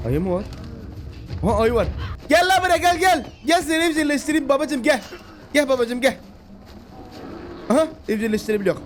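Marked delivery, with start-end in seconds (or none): 2.3-6.2 coaxing call to a bear. Real, not acted.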